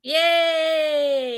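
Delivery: long, high, loud yay; drawn-out cheer of celebration. Real, not acted. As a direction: let out a playful whoop of success. Cheers at correctly said introduction.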